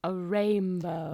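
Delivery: slow, stretched-out words; drawn-out reveal. Real, not acted. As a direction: draw each word out slowly. Drawn-out reveal answering the co-host.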